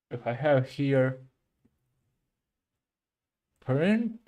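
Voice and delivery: talking around a pen in the mouth. Real, not acted. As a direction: speak with a pen held in the mouth. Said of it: "I'm keeping a pen in my mouth".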